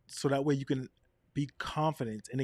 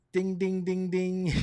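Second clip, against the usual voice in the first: sing-song ding ding chime; mock bell ding; 5 semitones higher, 4 dB louder, slower than usual. Chiming ding ding.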